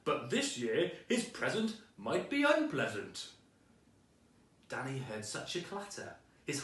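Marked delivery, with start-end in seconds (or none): 0.0-3.3 a louder, put-on Santa voice with a sly edge; Santa's voice. A narrator's reading. Santa warns about Danny's gift, in a louder put-on voice. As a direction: Santa promising a nasty surprise. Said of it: "So Big Santa C said".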